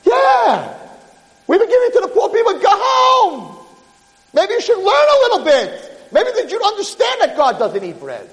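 high, heated dismissal; scornful scolding. Acted. Rabbi sends the ignorant peasant home, scornful scolding. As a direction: send him away with angry contempt.

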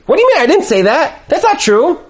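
high-pitched acted protest; indignant denial. Spouse answering an accusation, indignant high denial.

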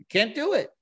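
high, firm refusal; emphatic refusal. Retold or imitated. David refusing to harm the anointed king, high and firm. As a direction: declare it flatly impossible. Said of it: "He says, he says".

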